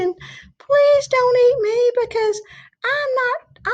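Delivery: high, pleading little goat; small goat voice. Acted. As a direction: squeak it like a tiny frightened goat.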